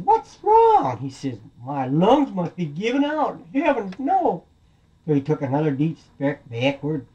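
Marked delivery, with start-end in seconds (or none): 0.0-1.0 loud, very high-pitched dismay; baffled wolf. Acted. Wolf whose blowing has failed, high comic dismay. 1.6-4.4 high, climbing cartoon alarm; dismayed wolf. Wolf worried his lungs are failing, high comic alarm.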